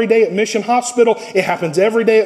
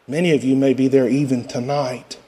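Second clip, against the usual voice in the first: usual speaking voice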